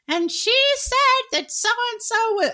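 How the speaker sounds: very high, slow gossipy accusation; mock reporter. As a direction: mimic a scandalized journalist. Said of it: "they're gonna be like".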